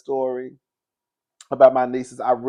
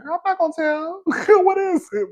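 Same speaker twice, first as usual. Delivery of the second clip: much higher, sweet innocent coaxing. Retold or imitated. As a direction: coax it high and innocent. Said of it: "I'm sitting here like".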